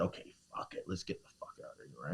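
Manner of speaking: hushed, half-whispered resolve; muttered to self. Retold or imitated. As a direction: say it under your breath. Retold private decision to flee.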